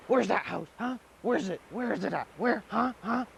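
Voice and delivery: high, pestering questions; can't wait to know where it is; eager demanding. Acted. Sagani grills grandma about the house, high eager pestering. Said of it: "Sagani says".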